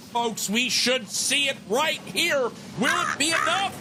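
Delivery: high, building hype; rising to the climax; excited commentary. Real, not acted. Caster hyping final attack.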